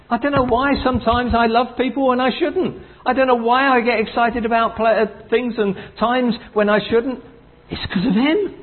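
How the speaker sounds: high, lifted preacher's testimony; exultant testimony; fervent testimony. Acted. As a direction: testify with rising joy.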